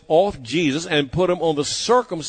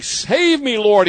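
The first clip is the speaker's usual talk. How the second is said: much higher, louder cry for help; shouted plea; 7 semitones higher, 4 dB louder, slower than usual. Acted. Peter sinking in the storm shouts to the Lord for help. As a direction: call out as if sinking, begging to be saved. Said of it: "he shouted".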